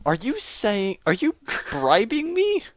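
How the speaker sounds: puzzled, rising high at the end; baffled, voice climbing into the question; confused. Acted. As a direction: ask slowly in hushed bewilderment. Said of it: "He looks at you confused".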